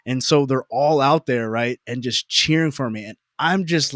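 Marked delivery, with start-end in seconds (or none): none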